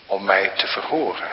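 ordinary delivery

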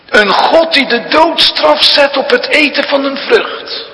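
mock God's harsh sentence as the tempter would; devil's mocking voice. Preacher voicing the devil to the congregation, sneering and loud. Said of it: "En dan zegt de duivel".

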